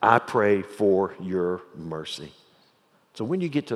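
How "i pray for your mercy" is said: slow, measured plea in a lower voice; solemn prayer. Acted. Preacher voicing David praying to God for mercy, slow and solemn. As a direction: pray it word by word, slowly. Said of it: "pray to you, and say".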